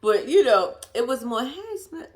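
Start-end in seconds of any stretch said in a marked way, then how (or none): 1.4-2.1 soft hey. Retold or imitated. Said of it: "That's how it was".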